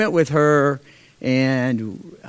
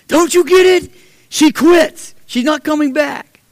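much higher, louder, sneering retort; angry boyfriend retorting; 10 semitones higher, 4 dB louder than usual. Retold or imitated. Boyfriend snapping at the landlord on the phone, loud and defiant. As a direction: throw it back at him, defiant.

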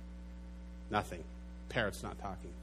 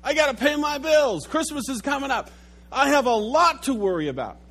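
fretful worrier; 9 semitones higher, 6 dB louder than usual. Acted. Voicing a worrier's complaints.

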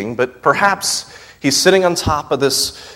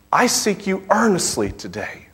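higher, rising prayerful plea; fervent prayer; 5 semitones higher than usual. Acted. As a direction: pray it as your own longing. Preacher prays the verse.

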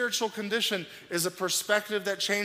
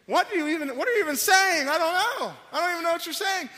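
higher, baffled parent pleading; exasperated father; 10 semitones higher, 3 dB louder, faster than usual. Retold or imitated. Father baffled by his little son's tantrum, exasperated. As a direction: act out a dad who can't understand his crying son. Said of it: "I'm thinking".

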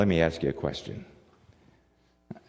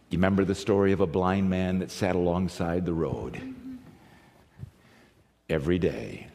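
ordinary delivery

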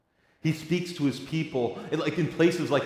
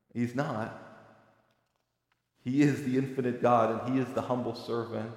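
normal talking voice

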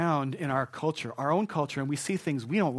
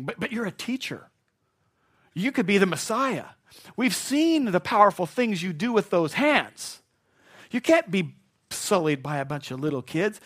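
higher, insistent objection, growing louder; protesting disciples; 5 semitones higher, 5 dB louder than usual. Acted. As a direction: plead with the teacher in disbelief. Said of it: "But they're thinking".

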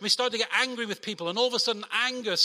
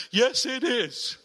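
slow, higher, louder cheeky answer with a laugh; cheeky slow "yes, it is" with a chuckle; cheeky defiance; 6 semitones higher, 4 dB louder, much slower than usual. Retold or imitated. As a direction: play Jonah's barefaced cheek, stretching it out. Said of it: "the barefaced cheek of Jonah".

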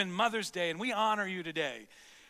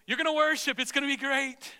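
mock pep talk; 6 semitones higher, 6 dB louder than usual. Mock promise from God.

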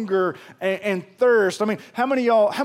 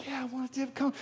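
pitiful pleading; 5 semitones higher, 11 dB quieter than usual. Retold or imitated. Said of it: "And I'm like".

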